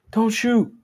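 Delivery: high, loud warning call; urgent shout. Acted. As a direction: call out to stop the gunfire. Cop shouting to other officers.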